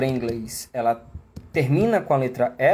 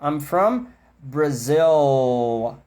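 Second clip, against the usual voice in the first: very slow I'm from Brazil. with long final L; slow stretched version; much slower than usual. Shown as a demonstration. Teacher contrasting stretched demo with fast speech, slow. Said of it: "na fala rápida eles não esticam tanto".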